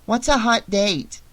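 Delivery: put-on high child's voice asking; little kid. A narrator's reading. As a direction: ask it as a puzzled little girl. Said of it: "he heard the kid".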